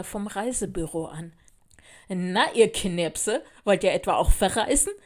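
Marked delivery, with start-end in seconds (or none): 2.1-4.9 loud, teasing grin in the voice; grinning condescension. A narrator's reading. Older girl addresses younger kids, grinning smugly. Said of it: "grinste sie uns an".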